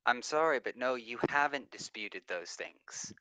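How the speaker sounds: ordinary talk, nothing marked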